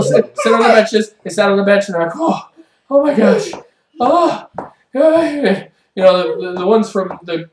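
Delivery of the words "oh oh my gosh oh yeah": higher, mock-winded groaning; acted exhaustion. Worn-out players collapsing on the bench, mock exhaustion. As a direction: pant it out in short, separated bursts.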